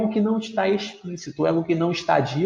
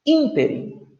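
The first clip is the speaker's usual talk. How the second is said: "Ínterim" with a high-pitched first syllable; ÍN-te-rim, stress at the start of "Ínterim"; stressed antepenult syllable; 8 semitones higher, slower than usual. Shown as a demonstration. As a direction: hit the ÍN of "Ínterim". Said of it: "antepenúltima".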